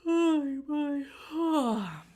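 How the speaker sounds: loud, high yawn sigh. Shown as a demonstration. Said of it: "and then yawn".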